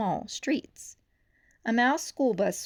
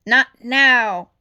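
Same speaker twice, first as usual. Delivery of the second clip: louder firm refusal; firm brush-off; 4 semitones higher, 15 dB louder than usual. A narrator's reading. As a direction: wave it off curtly. Chief brushing off intercom.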